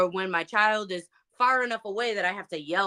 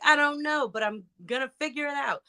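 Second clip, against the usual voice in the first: higher, lightly voiced younger self; kid self; 7 semitones higher than usual. Kid answering people about the business, lightly voiced higher child self. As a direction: answer back as your kid self, pitched up. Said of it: "as a kid".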